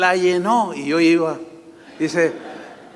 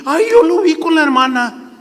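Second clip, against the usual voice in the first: high, loud gossip's voice; gossiping churchwoman spreading a rumor; gossipy church sister; 10 semitones higher, 5 dB louder than usual. Acted. Gossipy sister reporting what she saw, high and loud. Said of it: "la hermanita chismosa".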